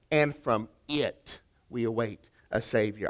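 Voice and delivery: "it" set apart and leaned on; stress on IT. Shown as a demonstration. Preacher contrasting our Savior with theirs, stress on "it". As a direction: land firmly on "it", then ease off. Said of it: "the emphasis here really is on".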